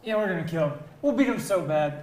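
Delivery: loud, hyped-up boast; excited. Real, not acted. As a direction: shout it like a fired-up fan. Hyped pick for home team.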